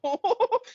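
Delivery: high-pitched flustered burst of laughter; high nervous laugh. Real, not acted. Pushed by friends to do an accent, laughs high and loud.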